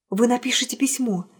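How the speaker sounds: ordinary delivery, nothing marked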